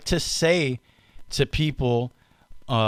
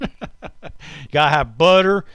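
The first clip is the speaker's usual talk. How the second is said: slow, drawn-out put-on voice with a laugh; funny bread voice; slower than usual. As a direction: stretch out "butter" in a silly voice. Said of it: "what that voice is".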